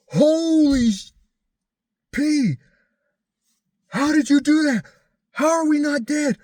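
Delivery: high, loud, frantic disbelief; freaking out. Retold or imitated. Woman in the back seat freaking out after the near miss, high frantic shrieks. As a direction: shriek it like you just cheated death. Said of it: "Going, just going nuts".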